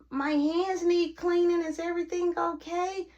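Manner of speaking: innocent high-voiced interruption; mimicked roommate. Acts out Kayla interrupting at door.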